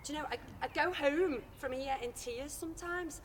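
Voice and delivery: ordinary talk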